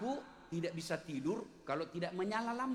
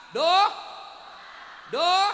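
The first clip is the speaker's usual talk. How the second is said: exclaimed doh; 8 semitones higher, 12 dB louder than usual. Shown as a demonstration. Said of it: "jadi ada masalah".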